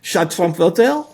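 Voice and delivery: badly faked French accent through a giggle. Analyst doing a requested French voice, laughing. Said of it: "we need your French accent".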